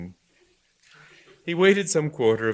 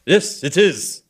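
defiant; 8 semitones higher, 9 dB louder, slower than usual. Acted. Bishop arguing back.

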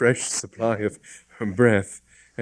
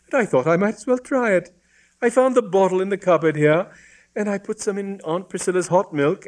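put-on high-pitched, lively girl's voice; high, pleased female character; brightened young woman; 8 semitones higher than usual. A narrator's reading. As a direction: confess cheerfully as an innocent girl. Voices the girl, now cheered up.

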